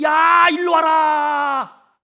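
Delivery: very slow, loud, high far-off shout; calling demon. Retold or imitated. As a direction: call your friends from afar like a demon. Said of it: "나갔던 귀신들도". (멀리 있는 귀신들을 부르는 외침)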